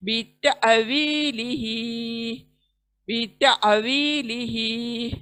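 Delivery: slow बीता वी लिही with a small catch in the throat after ता and वी held long; slow tajweed reading of बीता वी लिही with a throat jolt; recitation with a glottal catch. Shown as a demonstration. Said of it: "छोटा सा झटका हलक के अंदर".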